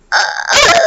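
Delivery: short high-pitched cry; mock shocked shriek. Loveby hears she was adopted, high mock-shocked cry. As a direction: let out a squeaky mock wail. Loveby's reaction to cruel news, imitated.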